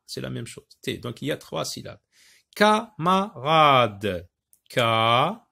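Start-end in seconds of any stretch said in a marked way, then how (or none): 2.5-5.5 Camarade stretched as ca-ma-rade; loud, deliberate beats on Camarade; slow syllabic reading. Shown as a demonstration. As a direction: draw out Camarade part by part. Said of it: "la consonne qui se trouve devant une voyelle".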